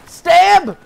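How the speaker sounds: sudden high yelled burst; shouted stab. Real, not acted. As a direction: bark it out as you lunge. Sniper stabbing an enemy.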